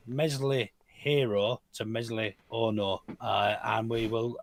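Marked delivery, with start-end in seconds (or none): 0.1-3.0 slow, drawn-out title announcement; stretched first syllable. Real, not acted. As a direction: stretch each name out slowly.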